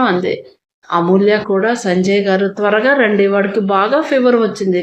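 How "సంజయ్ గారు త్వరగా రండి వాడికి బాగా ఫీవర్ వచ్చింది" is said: ordinary delivery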